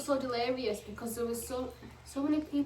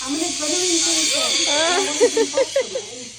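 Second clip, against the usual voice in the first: loud, eager gushing; excited; 5 semitones higher, 12 dB louder than usual. Real, not acted. Gushing about zip-lining wish.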